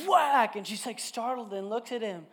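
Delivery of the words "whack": put-on high, loud little-boy whack; toddler's whack. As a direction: bark it like a two-year-old hitting. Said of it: "he just goes".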